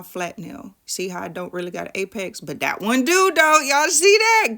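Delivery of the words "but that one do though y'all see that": excited. Real, not acted. Showing off her perfect apex.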